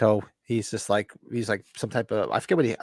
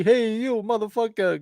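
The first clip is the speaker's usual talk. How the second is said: high, hammed-up tough guy after Rocky; mock tough-guy line; 10 semitones higher than usual. Quoting Eddie Murphy's moviegoers leaving Rocky, mock tough voice. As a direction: lightly imitate a pumped moviegoer. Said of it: "He was talking about how".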